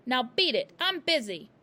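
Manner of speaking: loud brusque brush-off; dismissive father. A narrator's reading. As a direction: shoo the girl away.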